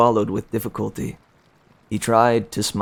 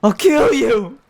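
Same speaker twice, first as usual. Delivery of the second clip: put-on high, loud furious boy's yell; 7 dB louder than usual. The boy yells a threat at the guards, high and loud. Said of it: "he yelled at the guards".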